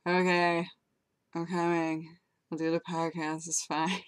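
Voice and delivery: imitating a just-woken friend, softly; sleepy friend. Friend woken by a phone call agreeing to the podcast, imitated sleepy. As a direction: play him groggy and soft, barely awake. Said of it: "he sounded so sleepy".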